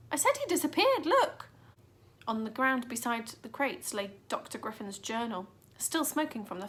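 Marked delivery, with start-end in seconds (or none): 0.1-1.5 high, louder insistence; girl insisting to the doubting boy; irritated insistence. A narrator's reading. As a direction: insist impatiently.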